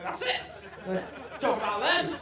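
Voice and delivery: loud eureka burst; sudden loud excited idea; excited realization. Retold or imitated. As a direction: blurt it out like a brainwave. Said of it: "and I was like".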